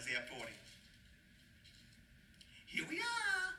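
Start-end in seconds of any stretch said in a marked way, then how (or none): 2.7-3.5 high, drawn-out singsong call; mock-grand high-pitched echo; playful echo. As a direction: repeat it teasingly way up high. Playfully repeats the verse's phrase.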